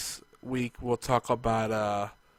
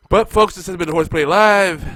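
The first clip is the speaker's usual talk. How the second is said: announcer voice; 7 semitones higher, 13 dB louder than usual. Real, not acted. Sign-off to the audience.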